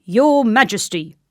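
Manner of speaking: loud, formal address to a king; put-on official soldier voice. A narrator's reading. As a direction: hail the king as a dutiful guard. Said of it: "said the soldier".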